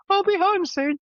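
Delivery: high squeaky sing-song; a chirpy high little voice into the camera; sing-song message home. Retold or imitated. Sending a sing-song selfie message home to wife, squeaky high.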